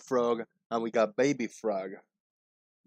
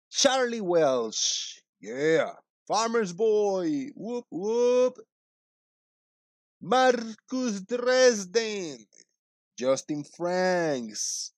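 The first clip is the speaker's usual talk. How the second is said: announcer shout-outs; 8 semitones higher, much slower than usual. Acted. Announcing chat names slowly.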